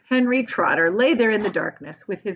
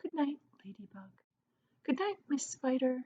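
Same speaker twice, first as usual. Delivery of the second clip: soft drowsy whisper, fading; a boy drifting off, whispering; sleepy whisper; 5 semitones higher, 12 dB quieter than usual. A narrator's reading. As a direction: whisper goodnights half asleep. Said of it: "he whispered".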